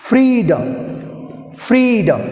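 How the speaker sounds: "Freedom" with high, held FREE, then a fall; teacher modelling "Freedom", stressed at the start; first-syllable stress. Shown as a demonstration. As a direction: push FREE, drop the end of "Freedom". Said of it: "stress, stress ఉంది".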